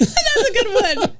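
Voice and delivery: slow words through laughter; laughing. Real, not acted. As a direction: laugh while praising the joke. Laughing reaction.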